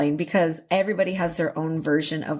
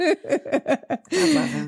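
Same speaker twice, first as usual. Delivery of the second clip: laughing; 8 semitones higher, much slower than usual. Real, not acted. Laughing fondly.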